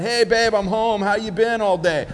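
husband greeting his wife, bright and eager; cheery husband. Pretend.

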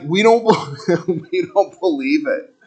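stifling a laugh while denying; mock doubters laughing it off; laughing disbelief. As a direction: say it with a giggle in your voice. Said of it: "And they're like".

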